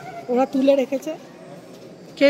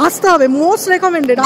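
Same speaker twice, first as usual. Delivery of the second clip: slow, loud insistence; emphatic recommendation; 2 semitones higher, 8 dB louder, much slower than usual. Real, not acted. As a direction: stretch it out to insist. Urging viewers to visit.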